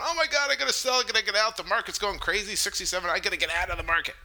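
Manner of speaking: high, frantic imitation of panicking sellers; mock panicked freak-out. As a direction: babble it in a hammed-up panic, starting high. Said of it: "there are people freaking out".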